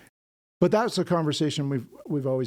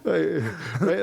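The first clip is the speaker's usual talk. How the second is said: laughing; 5 semitones higher, 5 dB louder, much slower than usual. Real, not acted. Laughing at own joke.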